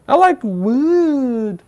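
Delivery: high, slow, drawn-out cartoon pig voice; squealing "Woo!" then a slow, high pig line; first little pig. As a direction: stretch it out like a giddy little pig. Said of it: "the second pig was".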